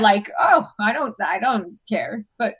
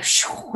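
unvoiced rushing whoosh; airy "shoo" sound effect; imitated whoosh. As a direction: make a whooshing noise for rising energy. Imitating energy lifting in crowd.

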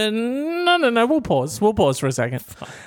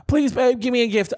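lightly voiced, higher pleading girlfriend; 8 semitones higher than usual. Girlfriend begging boyfriend for a present, pleading. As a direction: wheedle it like a girlfriend, pitched up. Acting out the couple's exchange.

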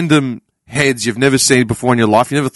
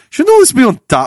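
high-pitched exasperated question; worked-up disbelief, pitched up; incredulous; 10 semitones higher than usual. Retold or imitated. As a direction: marvel at the backwardness. Retold rant about paper folders.

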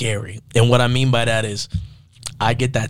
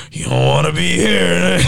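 drawn-out, louder mock warning; 5 semitones higher, 4 dB louder, slower than usual. Older self warning the next young you, hammed-up. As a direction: play a regretful elder, higher and slower.

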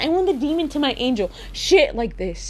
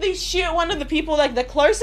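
ordinary talk

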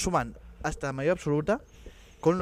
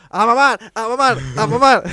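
chanting shout, high and loud; 6 semitones higher, 12 dB louder than usual. Retold or imitated. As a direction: yell it like a crazed fan. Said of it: "y se puso".

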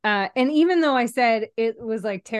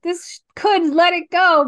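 high, loud retold outburst; mock emotional outburst; 9 semitones higher, 6 dB louder than usual. Host retelling a near-cry at a film, mock outburst. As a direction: act out feelings about to burst. Acts out urge to finally cry.

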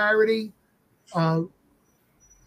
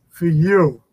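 proud; 4 semitones lower, 4 dB louder than usual. Retold or imitated. Dad peeking through the curtain at the sold-out crowd, proud. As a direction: let the doubt melt into pride. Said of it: "to being proud of it".

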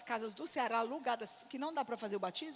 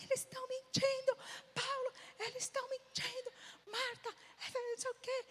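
mock indignant whine, pitched way up; angry complaint, imitated; 12 semitones higher than usual. Church member phoning her pastors to report liars, high indignant whine. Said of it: "Eu era brava".

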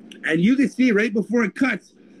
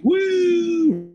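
excited whoop; 11 semitones higher than usual. Retold or imitated. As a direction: whoop at the light in the sky. Telling of UFO streak on cam.

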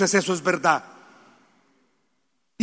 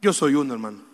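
weary confession; 8 semitones lower, 5 dB quieter than usual. Real, not acted. Confessing weariness to congregation.

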